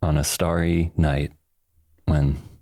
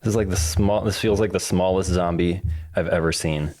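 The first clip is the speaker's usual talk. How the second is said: normal talking voice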